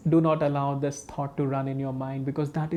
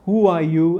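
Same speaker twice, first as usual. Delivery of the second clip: big voice, much louder challenge; 2 semitones higher, 9 dB louder than usual. Retold or imitated. Disciple answers the saint's knock, very big voice. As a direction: demand it loudly in a very big voice. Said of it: "he was in a very big voice".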